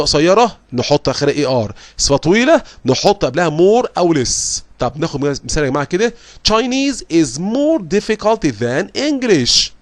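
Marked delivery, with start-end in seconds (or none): 6.5-9.7 slow, higher example read; slow English reading voice. Acted. Teacher modelling the example sentence for students. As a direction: spell out the example sentence slowly.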